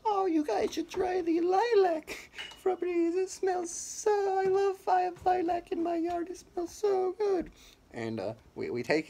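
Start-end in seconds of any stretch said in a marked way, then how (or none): 0.1-7.5 put-on very high, slow sweet old-lady voice. Old lady in a scooter recommending Febreze, very high and slow. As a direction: croon it like a gushing old lady, way up high and drawn out. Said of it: "she's like".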